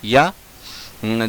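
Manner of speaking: normal talking voice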